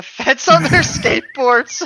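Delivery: can barely get the words out for giggling; laughing through the line. Real, not acted. Reading the story to her friend, bursting out laughing. Cracks up mid-sentence reading.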